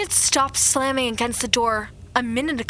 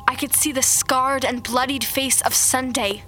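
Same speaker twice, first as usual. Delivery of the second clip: plain reading voice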